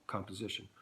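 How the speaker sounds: usual speaking voice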